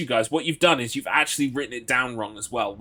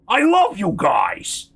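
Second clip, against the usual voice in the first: higher, louder and slow big furry monster; big hairy Muppet; 8 semitones higher, 8 dB louder, much slower than usual. Retold or imitated. Retelling Muppet Treasure Island, the hairy one thanks the pirates in a booming voice. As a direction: boom it out like a lovable hairy beast. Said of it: "that's how I feel about Americans".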